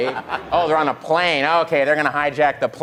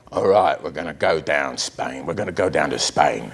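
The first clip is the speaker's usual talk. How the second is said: put-on low, mumbled London gangster accent; thick Cockney accent, low; 12 semitones lower than usual. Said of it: "an impression of an accent nobody can fully understand".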